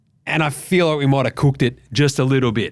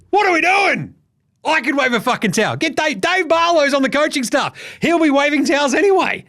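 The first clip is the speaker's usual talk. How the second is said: much higher, incredulous rant; exasperated outburst; 11 semitones higher than usual. Real, not acted. Ranting about selectors picking towel-wavers, high and incredulous. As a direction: pitch up in disbelief.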